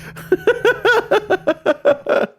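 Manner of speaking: long, high-pitched giggling fit; high giggle. Real, not acted. Laughing at own Myers joke, high giggle. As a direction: crack up in a rapid high giggle.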